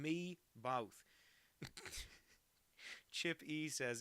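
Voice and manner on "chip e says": ordinary talk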